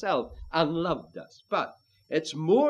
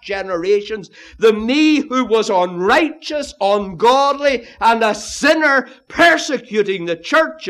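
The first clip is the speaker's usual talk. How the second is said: وعظ حماسي; 5 semitones higher, 8 dB louder than usual. Real, not acted. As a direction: thunder out each contrast to the congregation. Preaching at full force.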